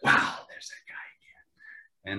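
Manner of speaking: slow, hushed whisper of wonder; awed whisper. Retold or imitated. Actor remembering watching Jon Voight, whispering in awe.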